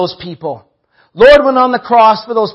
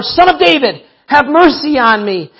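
higher, insistent cry for mercy; crying out harder; 6 semitones higher than usual. A narrator's reading. Blind man refuses to be silenced, crying out harder. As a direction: call out all the more, pitch raised. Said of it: "he cried out all the more".

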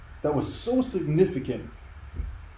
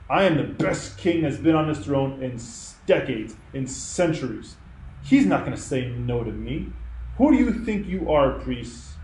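imitating a furious king; 3 semitones higher than usual. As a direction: rage like a proud king who won't be told. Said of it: "I can just hear him right now".

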